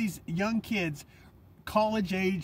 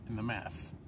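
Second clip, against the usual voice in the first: ordinary talk, nothing marked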